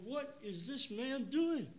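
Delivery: high, slow, puzzled inner question; bewildered. Retold or imitated. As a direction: drag it out in disbelief. Retells his bewildered thought.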